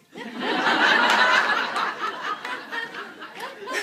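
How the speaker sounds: loud high burst of laughter; big laugh. Real, not acted. Speaker laughing hard at the story, high and loud. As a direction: let the laugh roll out.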